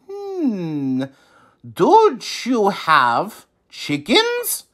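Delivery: slow musing hum and question; wise old man. A narrator's reading. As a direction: ponder it slowly like a sage. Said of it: "the wise man asked".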